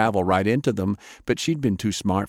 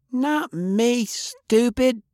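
much higher shriek; much slower than usual. Acted. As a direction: shriek it while vanishing. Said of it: "she shrieked as she traveled".

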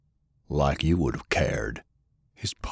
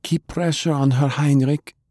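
higher, busy healer; Jane's voice; 8 semitones higher than usual. A narrator's reading. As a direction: toss it off, hands full. Jane instructing.

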